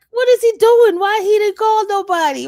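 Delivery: high, loud, baffled demand; exasperated outcry. Retold or imitated. She demands why the attorney never called the witnesses, loud and high. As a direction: cry out in disbelief at him.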